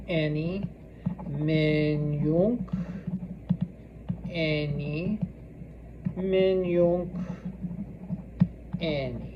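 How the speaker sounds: drawn-out words, long pauses between; slow dictation. Shown as a demonstration. Teacher saying it as students write it three times, slowly. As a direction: stretch each word for writing.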